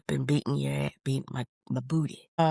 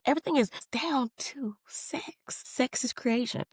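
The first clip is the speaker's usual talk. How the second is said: breathy hushed whisper; 7 semitones higher, 4 dB quieter than usual. Real, not acted. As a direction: drop to a secretive whisper. Hushed point about sex.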